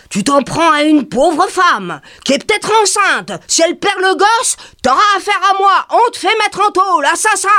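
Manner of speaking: indignant threats to the manager; threatening older woman. Retold or imitated.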